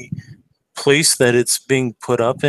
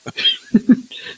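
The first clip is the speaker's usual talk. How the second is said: breathy high laugh; 12 semitones higher than usual. Real, not acted. Laughter at the coffee joke.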